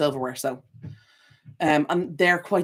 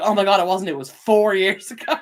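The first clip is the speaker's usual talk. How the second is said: louder, higher astonished outburst; mock shock; 4 semitones higher, 7 dB louder than usual. Correcting someone about covid's length, mock shock. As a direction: exclaim it in disbelief, laughing on four.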